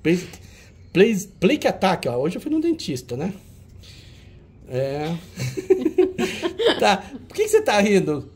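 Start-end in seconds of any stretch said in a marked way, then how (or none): 5.2-6.8 high laugh. Real, not acted. Laughing at dentist remark.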